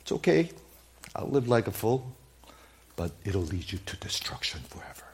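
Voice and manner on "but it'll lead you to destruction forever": hushed, grave warning; whispered warning. Real, not acted. Pastor warning the congregation of destruction, whispered. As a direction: breathe the warning out.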